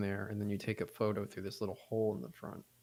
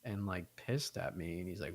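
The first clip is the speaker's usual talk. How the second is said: ordinary talk, nothing marked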